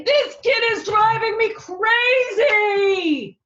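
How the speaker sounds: high, loud exasperated shout; mother yelling about her toddler; fed-up mother. Acted. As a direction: shout it like she's at the end of her rope. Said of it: "Sometimes his mother would say".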